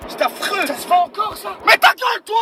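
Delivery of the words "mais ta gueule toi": high, louder yell of shut up; shouted shut up. Acted. Driver yelling shut up at the singing passenger. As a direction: blast the passenger into silence.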